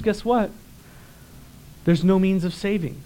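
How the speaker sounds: normal talking voice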